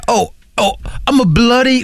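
flustered Englishman, mock British accent. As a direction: put on a hammy British accent. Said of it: "old guy with a British accent".

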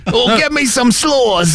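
high, drawn-out British caricature; mock British accent. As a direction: beg slowly in a silly British accent. Riffing as the British tourist.

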